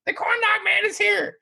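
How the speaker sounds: high shouted alarm call; announcing over speaker. Retold or imitated. Coworker blasting the news over the drive-through speaker, high shout. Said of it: "All over the, the drive-through speaker".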